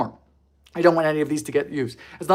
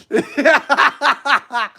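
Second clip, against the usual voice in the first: laughing; 10 semitones higher, 5 dB louder than usual. Real, not acted. Laughing at the cookies.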